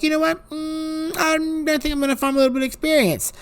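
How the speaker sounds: playful high-pitched aside. Retold or imitated. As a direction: toy with the idea innocently. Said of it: "I'm just like".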